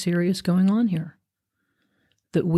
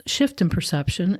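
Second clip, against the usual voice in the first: usual speaking voice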